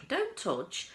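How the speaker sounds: high, loud sharp don't touch; shouted warning. A narrator's reading. Wonka shouting at the children not to touch, loud. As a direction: bark the warning at the kids. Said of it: "shouted Mister Wonka".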